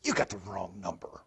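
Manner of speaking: low, curt dismissal of a caller; abrupt, half-voiced rebuff; abrupt brush-off. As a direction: brush off a late-night caller, voice kept down. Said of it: "kind of abruptly told her".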